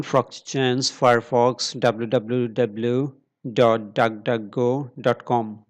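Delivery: usual speaking voice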